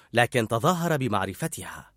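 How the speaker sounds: ordinary delivery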